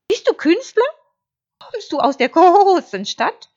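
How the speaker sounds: high, loud, eager sheep questions; curious. A narrator's reading. Sheep quizzing the wolf about himself, curious. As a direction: ask with wide-eyed curiosity, high and bleating. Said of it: "fragte Schaf neugierig".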